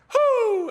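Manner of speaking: high loud hooting call; owl hoot call. Retold or imitated. Dad calling to the owl off the dock, a high hoot. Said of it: "He's like".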